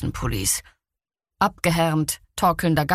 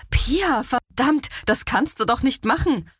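high-pitched, upset scolding; acted dismay, pitched high; appalled reproach; 6 semitones higher than usual. A narrator's reading. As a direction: protest in shock at your friend. Emma appalled at friend's punch.

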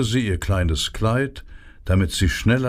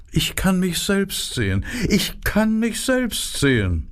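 vain narcissus; 10 semitones higher than usual. A narrator's reading. Dancer repeats narcissus line.

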